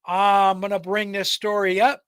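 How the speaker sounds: plain reading voice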